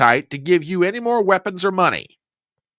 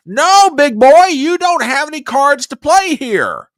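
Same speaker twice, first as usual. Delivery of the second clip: much higher and louder, slowed down; emphatic rebuttal; 12 semitones higher, 8 dB louder, slower than usual. Real, not acted. Host shouting down Zelensky's demand, high and slow. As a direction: slam the point home, shouting.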